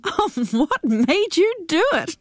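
high, slow, amused through laughter; laughing. A narrator's reading. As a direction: tease her while laughing. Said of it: "Jackie laughed".

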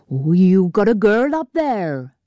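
lightly voiced teasing Welsh miner, slow; a hint of a Welsh lilt, mocking. A narrator's reading. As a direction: sailor ribbing him in a light Welsh accent.

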